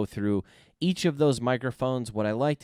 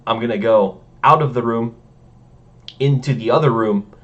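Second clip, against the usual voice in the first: ordinary talk, nothing marked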